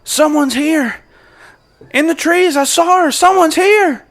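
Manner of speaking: very high, loud alarm shout; alarmed call out. Acted. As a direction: yell to raise the alarm across the yard. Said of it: "you hear Wyatt's voice call out".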